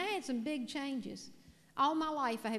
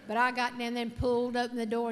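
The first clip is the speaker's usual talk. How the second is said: normal talking voice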